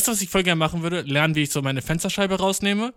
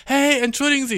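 polite stranger calling; 10 semitones higher, 3 dB louder than usual. Retold or imitated. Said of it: "Leute schreiben mir so hinterher so".

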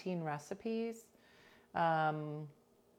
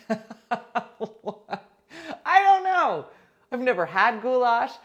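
loud, high burst through laughter; laugh, then blurt it out high; cracking up, voice leaping up; helpless laughing; 5 semitones higher, 10 dB louder than usual. Real, not acted. Cracking up over the funny word.

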